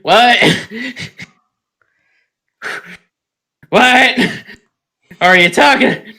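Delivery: loud, high, disbelieving outburst; mock incredulity. Teased friend denying a bias, loudly mock-incredulous. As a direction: play affronted, at full volume. Feigns outrage at teasing about bias.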